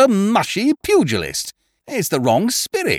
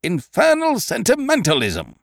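higher, indignant tirade; vigorous indignation; 7 semitones higher than usual. Acted. Eucridge ranting about his boxer's softness. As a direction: rant with wounded vigour. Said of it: "throwing off his lethargy with a vigour that spoke of mental anguish".

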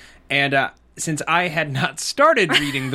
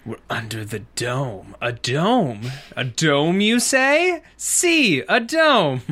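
mock-dramatic; slower than usual. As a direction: ham it up theatrically. Hammy mock-dramatic riff on the phrase.

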